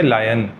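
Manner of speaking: लायन said as "laa-yan", a clear long aa; correct lion. Shown as a demonstration. Teacher showing students the right way to say लायन. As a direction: say लायन with aa then yan, not loy. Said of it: "ध्यान रखिए".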